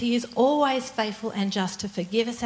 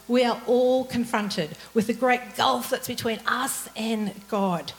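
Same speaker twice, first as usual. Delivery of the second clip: ordinary talk, nothing marked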